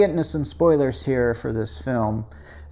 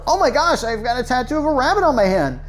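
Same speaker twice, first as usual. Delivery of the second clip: mock surprise; 6 semitones higher than usual. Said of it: "as if it's like".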